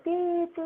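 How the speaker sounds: high-pitched imitated alarm beep. Alarm ringing when he leaves the bed, imitated beep. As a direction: imitate a shrill electronic beep, far above speaking pitch. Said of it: "it starts ringing. It goes like".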